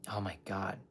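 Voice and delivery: breathless whispered aside; hushed remembered dread. Real, not acted. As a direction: whisper it, almost to yourself. Recalling a terrible time.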